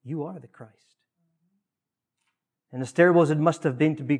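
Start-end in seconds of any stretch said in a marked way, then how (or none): 0.0-1.0 soft, humble confession; hushed and reverent statement. Acted. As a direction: say it quietly, as a lowly acknowledgement. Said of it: "he humbly says".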